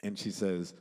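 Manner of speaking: ordinary delivery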